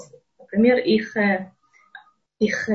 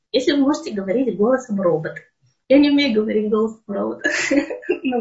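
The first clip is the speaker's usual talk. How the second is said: ordinary delivery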